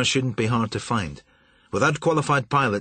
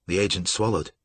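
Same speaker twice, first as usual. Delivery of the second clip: ordinary delivery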